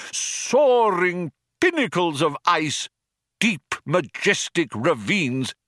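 high, slow, grand indignation; impassioned outcry. A narrator's reading. Old man defends glaciers as art, impassioned. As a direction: declaim each phrase with wounded passion. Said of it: "cried the old man".

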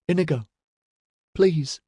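high, imploring appeal at no extra volume; distressed cry. A narrator's reading. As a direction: beg him in distress. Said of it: "she cried".

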